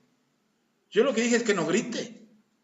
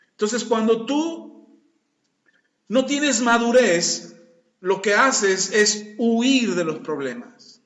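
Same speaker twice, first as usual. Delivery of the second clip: slow, louder, word by word; emphatic preaching; 3 dB louder, slower than usual. Real, not acted. Pastor stressing to the congregation that immaturity flees problems. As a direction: drive the point home slowly. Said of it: "preaching emphasis".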